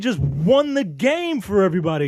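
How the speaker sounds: mock excitement. Host mocks the basketball scene with high gushing excitement. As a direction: gush over the win sarcastically.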